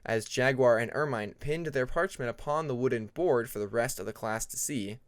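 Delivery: usual speaking voice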